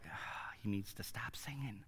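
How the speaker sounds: whispered mock aside; hushed, jokey imitation of an onlooker; imitating disapproving listeners. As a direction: mimic the congregation's grumble under your breath. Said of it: "Some of you looking at me like".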